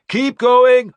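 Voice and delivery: shouted urging. A narrator's reading. Warlord driving his shield wall forward, loud yell. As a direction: drive them forward. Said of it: "I shouted".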